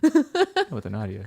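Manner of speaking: high, slow Russian-tinged "Nadia"; Russian-tinged accent. Acted. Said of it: "a little bit Russian".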